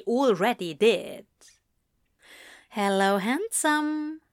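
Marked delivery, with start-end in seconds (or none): 2.7-4.2 high, slow sultry greeting. A narrator's reading. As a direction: purr it out, slow and flirty. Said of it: "came a sultry voice".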